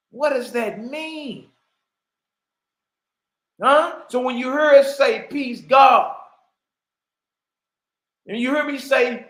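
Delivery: loud, rising proclamation; preaching shout. Real, not acted. Preaching to listeners about greeting God, loud. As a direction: proclaim it to the congregation.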